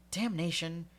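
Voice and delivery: loud, sharp curse; cursing. Acted. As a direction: bark the oath in anger. Howell curses torn sleeve.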